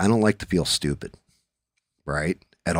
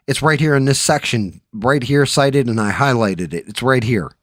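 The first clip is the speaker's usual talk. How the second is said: higher, louder, heated ranting about the bill; higher and louder worked-up rant; 4 semitones higher, 4 dB louder than usual. Retold or imitated. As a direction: fire off every finding like a conspiracy reveal. Ranting excitedly about bill findings.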